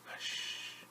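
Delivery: breathy shushing whisper; hushed whisper. Acted. Storyteller whispers hush to listeners. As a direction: hush the room so baby sleeps.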